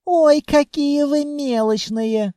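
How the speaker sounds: high, drawn-out scorn; disgusted whine. A narrator's reading. Blonde sneers at buyers wanting a refund, high disgusted whine. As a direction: whine it slowly with disdain. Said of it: "скривилась девица".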